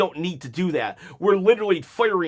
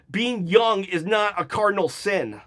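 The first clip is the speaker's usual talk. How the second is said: ordinary delivery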